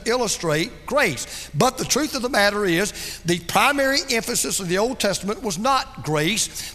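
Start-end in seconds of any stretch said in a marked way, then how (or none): none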